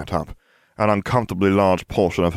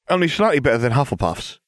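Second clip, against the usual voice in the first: put-on higher, chirpy girl's character voice; chirped; 7 semitones higher, faster than usual. A narrator's reading. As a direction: chirp it brightly at normal volume. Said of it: "Ginevra chirped".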